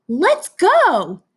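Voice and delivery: high, loud, excited cheer; super excited. Shown as a demonstration. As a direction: say it with a big exclamation mark. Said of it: "You would say it like that".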